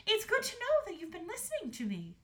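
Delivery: high-pitched approving mother; Queen Estelle. A narrator's reading. Queen pleased with the daughter, high-voiced. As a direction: praise your child in a high voice. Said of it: "said Queen Estelle".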